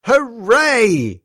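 high, drawn-out hooray; gleeful cheer. A narrator's reading. Theo cheers inwardly at the TV game, gleeful. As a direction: cheer like a delighted little boy.

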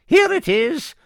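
very high, loud witch voice. Acted. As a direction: shriek it like a witch. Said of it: "said the witch".

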